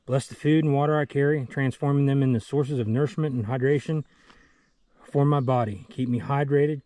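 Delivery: loud, earnest prayer. Acted. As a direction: pray aloud with fervor. Spoken prayer for food and water.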